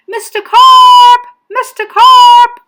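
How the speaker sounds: very loud, high, drawn-out calls; yell. A narrator's reading. Boy calling the man underwater, frantic loud yell. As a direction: holler the name slowly and long. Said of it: "I yelled".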